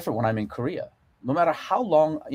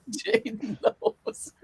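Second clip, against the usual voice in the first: slow words squeezed through laughter; laughing; 3 dB quieter, much slower than usual. Real, not acted. Teasing Jaden while still laughing, slow laughing words.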